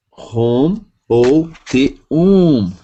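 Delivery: rum ou te um drawn out slowly; slow contrast. Shown as a demonstration. Teacher comparing two readings for students, slowly. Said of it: "teacher contrasts two readings".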